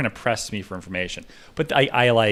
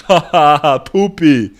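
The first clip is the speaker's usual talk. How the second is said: loud put-on cackle, pitched up; 5 semitones higher, 11 dB louder than usual. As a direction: hoot it out in the fake voice. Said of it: "He's like".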